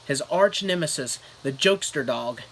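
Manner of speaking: ordinary delivery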